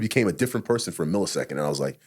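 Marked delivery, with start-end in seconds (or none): none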